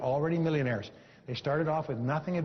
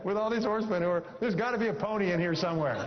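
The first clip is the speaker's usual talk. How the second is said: cheerful punchline; 5 semitones higher than usual. Retold or imitated. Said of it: "He said".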